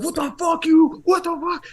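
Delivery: high, mock furious yell; angry ex-con. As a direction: snap at him like he's crossed a line. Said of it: "flipped out at me".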